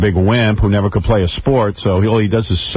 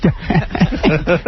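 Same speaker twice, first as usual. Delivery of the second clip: high self-amused chuckle; high mock giggle; 6 semitones higher than usual. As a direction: giggle at your own joke. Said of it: "He's breaking himself up".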